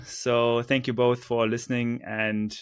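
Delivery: ordinary talk, nothing marked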